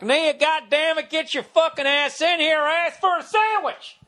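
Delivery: Southern voice. Acted. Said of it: "like, a Southern voice".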